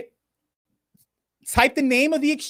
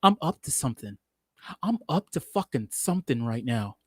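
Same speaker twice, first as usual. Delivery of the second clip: low, soft conspiratorial aside to the camera; sneaky scheming; 10 semitones lower, 10 dB quieter than usual. Pretend. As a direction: lean in and let them know you're up to something. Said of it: "You see how I'm leaning".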